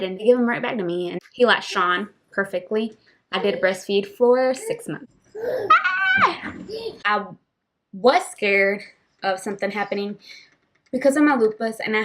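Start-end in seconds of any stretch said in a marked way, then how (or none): 5.4-6.5 high squeal of laughter; laughing squeal. Real, not acted. Telling viewers about breastfeeding, a high laughing squeal. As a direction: let out a sudden laughing shriek. Laughing outburst mid-story.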